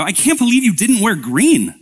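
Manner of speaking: high-pitched, teasing outburst; mock disbelief. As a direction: tease your spouse with playful astonishment. Spouse's teasing pinch, acted out.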